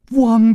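sudden loud, high imitated bark; dog bark. A narrator's reading. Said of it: "的一声叫". (学狗高声汪叫)

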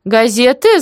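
high, loud rising question; perked-up auntie query; interested echo. A narrator's reading. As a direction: repeat it with sudden curiosity. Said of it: "заинтересованно переспросила Валентина".

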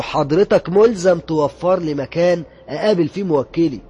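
loud, slow insistence on the law; firm demand. Retold or imitated. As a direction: insist loudly and slowly on his rights. Lawyer demanding rights firmly. (مطالبة حازمة بصوت عالي وبطء)

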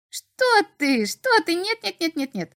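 high, quick imp denial; rattling denial. A narrator's reading. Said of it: "Снова затараторил тот".

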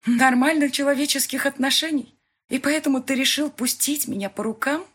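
high, loud bitter accusation; angry outcry. A narrator's reading. Heroine accuses her boss of selling her, angry outcry. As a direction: throw the accusation at him.

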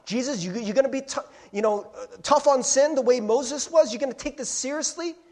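high, loud mock taunting; imitating a shouting crowd. Religious teachers shouting at Jesus, mock taunt. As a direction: goad Jesus like an angry crowd. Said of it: "They're shouting at Jesus".

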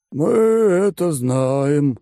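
high, slow, lazy condescending voice; bored rich glutton explaining terms; smug fat oligarch. Acted. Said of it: "согласился Толстожопый".

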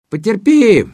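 high, loud bubbling call; gurgling. Acted. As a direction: gurgle it through water. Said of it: "пробулькал Мутила".

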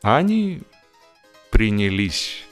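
plain reading voice

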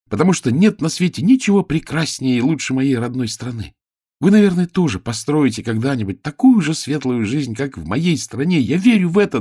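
high, loud and quick; ringing voice. A narrator's reading. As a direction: ring out across the city. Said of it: "голосок вдруг стал таким звонким".

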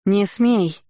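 restrained cry of warning; narrated yell. A narrator's reading. Julia rushing forward to stop Tamara, restrained yell. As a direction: call out a mild, urgent order to stop. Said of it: "заорала Джулия".

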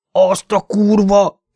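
drawn-out amazed cry at the photo; exclaiming. Acted. Louis gawking at the woman's photo, slow amazed exclamation. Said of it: "kiáltott fel".

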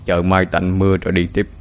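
lightly voiced old man, low-key; a hint of an aged teacher; old master. A narrator's reading. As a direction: answer the boy with quiet, measured authority. Old master answers his young disciple.

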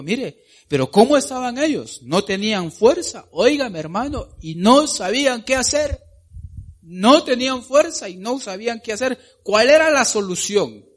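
high, loud preaching; preacher raising pitch and volume at the congregation; preaching at full force. Real, not acted. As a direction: drive the point home to the church.

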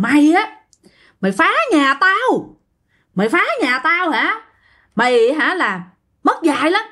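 high, raging spirit voice spitting threats; possessed angry threat. Retold or imitated. Possessed wife threatens uncle Phú for wrecking its home, furious high voice. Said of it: "mới nói rằng".

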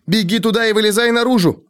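high desperate command; urgent shouting. A narrator's reading. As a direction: order him out, shouting. Repeats the run order.

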